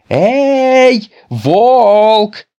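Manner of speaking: high, loud call out of breath; breathless shout. A narrator's reading. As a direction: yell after him, winded from running. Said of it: "закричал Петя, задыхаясь от быстрого бега". (Петя, запыхавшись, громко окликает волка)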